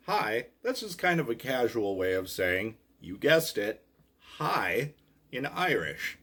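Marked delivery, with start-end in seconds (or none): none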